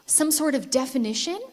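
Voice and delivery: ordinary delivery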